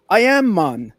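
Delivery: loud, high-pitched mock Jamaican accent; terrible Jamaican accent. Jamaican guy answering confused bouncer in a joke, bad Jamaican accent. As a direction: exclaim it in a hammy Jamaican accent. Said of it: "that's a terrible accent".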